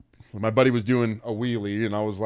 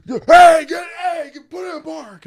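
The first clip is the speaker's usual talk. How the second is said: strained high-pitched shout, acted; hammed-up cop scream; cop yelling; 14 dB louder than usual. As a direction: bellow frantic orders at the driver. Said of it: "yelling at him and shit like that".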